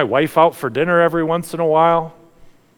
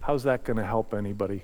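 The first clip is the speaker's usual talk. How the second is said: much softer, lower, quicker; hushed question; 7 semitones lower, 9 dB quieter, faster than usual. Acted. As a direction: murmur it in awe. Same question, now hushed reflection.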